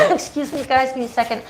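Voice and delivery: talking while choking on hair; strained voice, choking on hair. Real, not acted. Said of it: "I'm choking on something here".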